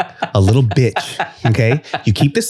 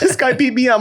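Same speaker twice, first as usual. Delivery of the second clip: put-on childlike; 10 semitones higher than usual. Voicing daughter.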